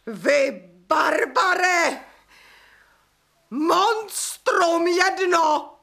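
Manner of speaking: slow, spat-out name-calling; furious insults. Acted. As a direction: hurl each insult at him. She hurls insults at him. (zuřivé nadávky)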